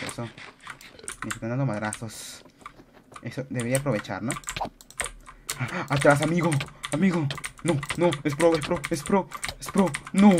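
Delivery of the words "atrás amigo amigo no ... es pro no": high loud panicked shouting; real alarmed cries; panicked yelling. Real, not acted. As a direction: yell in panic at a strong rival. Panicked at a pro player.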